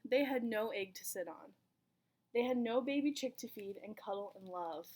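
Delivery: ordinary delivery, nothing marked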